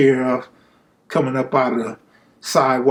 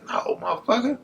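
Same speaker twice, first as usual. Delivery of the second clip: silent mouthed plea; 10 semitones higher, 6 dB quieter than usual. Retold or imitated. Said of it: "I'm not making no noise".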